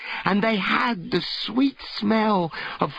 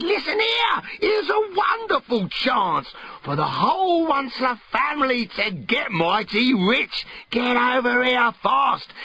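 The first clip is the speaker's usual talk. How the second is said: much higher excited shout of Listen here; eager call; 5 semitones higher than usual. Acted. The Once-ler rallies his family on the phone, excited. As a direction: hype up the family about riches.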